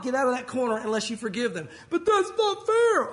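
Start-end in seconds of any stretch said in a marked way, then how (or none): 1.9-3.1 high, loud and very slow complaint; resentful listener whining back at the preacher's command; whiny protest. Pretend. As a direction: whine it like a sulking child in timeout. Congregant objecting to forgiving.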